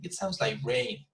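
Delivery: main emphasis on final "rain"; stress on rain. Shown as a demonstration. Teacher shows new information reading, stressing "rain". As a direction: let "rain" be the word that stands out. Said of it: "you're emphasizing on rain".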